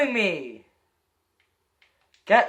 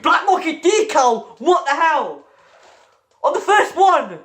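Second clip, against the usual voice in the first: stunned disbelief; 7 semitones higher, slower than usual. Real, not acted. Disbelief at rare drop.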